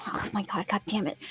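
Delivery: softly cursing to oneself; exasperated muttering. Acted. Eiko learns what is in the closet, muttering in exasperation. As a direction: mutter it under the breath, fed up.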